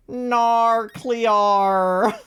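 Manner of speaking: loud, high, drawn-out meme cry. Acted. Streamer doing a favorite meme, loud drawn-out put-on voice. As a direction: belt it out long and exaggerated. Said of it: "I love doing that meme".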